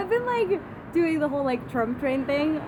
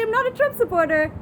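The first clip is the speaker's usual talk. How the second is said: retold protest; 7 semitones higher, 4 dB louder than usual. Retelling own retort in argument.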